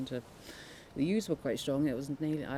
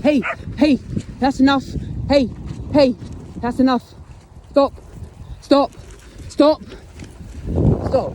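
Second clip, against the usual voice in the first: much higher, much louder commanding yells; shouting at dog; 11 semitones higher, 12 dB louder than usual. Real, not acted. [name] yelling at a dog attacking ewes, real shouts. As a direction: bark it at the dog to stop.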